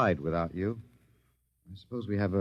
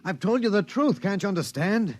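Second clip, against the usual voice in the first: desperate pleading; 8 semitones higher than usual. Acted. Pleading with the alien captor.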